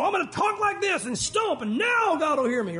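high, hammed-up loud praying; mock a stomping, shouty prayer; fake loud emotion. Shown as a demonstration. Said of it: "talking louder and stomping".

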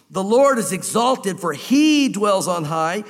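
slow, solemn reading of the verse; scripture reading. Acted. Preacher reading Isaiah to the congregation, slow and solemn. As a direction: read it slowly like sacred text.

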